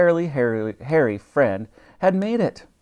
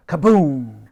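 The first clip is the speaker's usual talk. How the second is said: explosion noise; 7 dB louder than usual. A narrator's reading. Explosion in the story.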